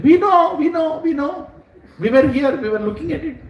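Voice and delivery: put-on high, eager small-child voice; excited little children. Little Gopa friends tell villagers what they saw, excited small children. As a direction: pipe up like a three-year-old witness. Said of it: "three-year-old, four-year-old, they're like".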